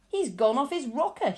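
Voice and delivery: loud, horrified cry; a father shouting in alarm; aghast shout. A narrator's reading. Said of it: "shouted one of the fathers, aghast".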